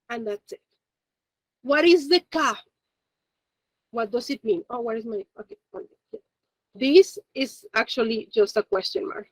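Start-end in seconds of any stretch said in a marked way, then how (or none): none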